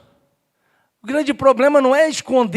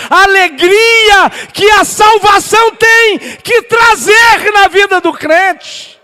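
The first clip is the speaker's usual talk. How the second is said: fervent shouted sermon; preaching at full force; 8 semitones higher, 10 dB louder than usual. Real, not acted. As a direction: thunder it out to the congregation, stretching key words.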